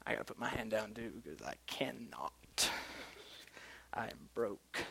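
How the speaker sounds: very soft, low confession; hushed aside. Real, not acted. Preacher admits to congregation he is broke, hushed aside. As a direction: drop right down into a near-whisper.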